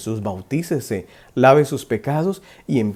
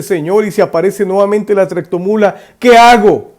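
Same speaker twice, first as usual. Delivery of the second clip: high, loud anxious cry; desperate; 7 semitones higher, 10 dB louder than usual. Retold or imitated. Desperate man asking Jesus about the truck, anxious cry. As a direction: call out in desperation. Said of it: "el hombre desesperado dice".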